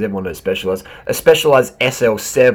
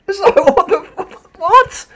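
laughing disbelief; 5 dB louder than usual. Real, not acted. Laughing at the gel claim.

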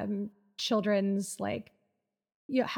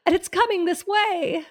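higher, louder scared exclamation; frightened cry; 8 semitones higher, 4 dB louder than usual. Acted. Gasping line read in blurb.

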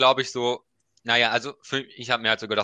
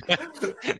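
high burst of laughter; 10 semitones higher than usual. Real, not acted. Laughs at her own T-shirt joke.

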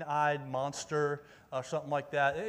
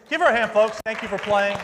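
loud, raised call for applause; rally the crowd to clap; 4 semitones higher, 12 dB louder than usual. Real, not acted. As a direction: project over the applause.

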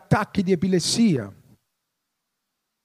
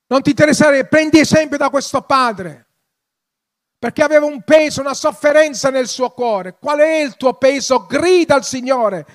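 loud, higher-pitched preaching; fervent exhortation; 3 semitones higher, 8 dB louder than usual. Real, not acted. Preacher urging congregation to follow the father's example, fervent and loud. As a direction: urge them forcefully, voice raised.

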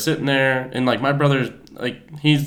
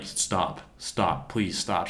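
soft, subdued imitation of begging; retold weary plea; 3 semitones lower, 7 dB quieter, much slower than usual. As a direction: plead under your breath as the embarrassed boyfriend. Retold embarrassed boyfriend pleading.

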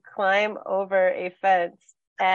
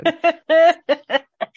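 loud, high burst of laughter; 8 semitones higher, 7 dB louder than usual. Real, not acted. Laughing at the smallest-road geocaching exception, amused. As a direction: crack up at the joke.

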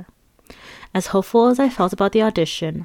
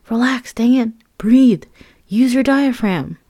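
higher, urgent self-coaching, slowing at the end; inner coach; 5 semitones higher, 3 dB louder, slower than usual. Retold or imitated. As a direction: talk yourself down like a vocal coach. Coaching herself mid-audition.

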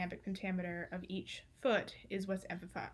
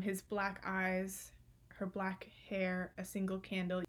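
slow and deliberate, each line set apart; measured poetry reading; slow, weighty line reading; 3 dB quieter than usual. Shown as a demonstration. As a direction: let every short line land on its own. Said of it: "on separate lines to put emphasis on them".

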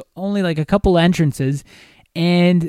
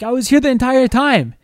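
exasperated; 7 semitones higher than usual. Retold or imitated. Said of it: "I'm like".

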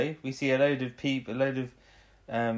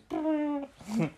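high-pitched imitated silly noise; short comic high-pitched sputter; funny noise. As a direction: make a silly high noise, mimicking her. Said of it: "she does a funny noise".

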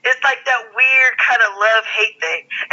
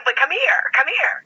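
squeaky voice; 5 semitones higher than usual. Retold or imitated. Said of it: "real kind of squeaky voice".

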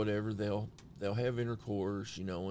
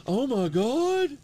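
high, loud, drawn-out mock outrage; shrieky horrified reproach, hammed up; scandalized shock; 13 semitones higher, 9 dB louder, slower than usual. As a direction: imitate an appalled partner. Partner's shocked reaction retold.

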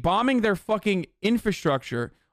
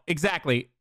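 usual speaking voice

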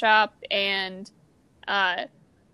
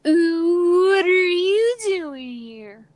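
put-on high, drawn-out parody California accent; loud, slow, exaggerated SNL-style California voice; Californians skit accent; 8 semitones higher, 5 dB louder, much slower than usual. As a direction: stretch it way out, hammed-up Californian. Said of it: "ever see that S N L skit".

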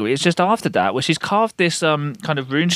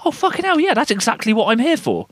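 mock eager teacher; 8 semitones higher than usual. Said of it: "they just go".

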